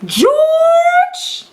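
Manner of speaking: mother screeching at her giant son; high screech. A narrator's reading. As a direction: screech his name like an angry mother. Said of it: "a high voice screeched".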